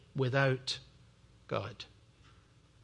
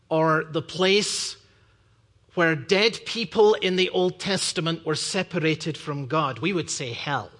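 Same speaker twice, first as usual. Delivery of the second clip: normal talking voice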